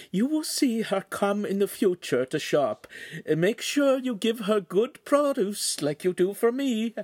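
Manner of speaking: a refined lady politely declining; signora's voice. Acted.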